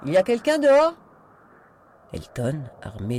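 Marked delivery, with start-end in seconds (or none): 0.1-0.9 high, loud shouted question; shouting. A narrator's reading. Voice from inside asking the man at the back door, shouting. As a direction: yell it out to someone outdoors. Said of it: "cria une voix de l'intérieur".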